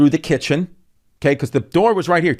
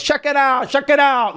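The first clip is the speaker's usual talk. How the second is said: Angel Salazar impression; 12 semitones higher than usual. Comic imitating Angel's entrance, high swaggering catchphrase. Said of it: "how he does".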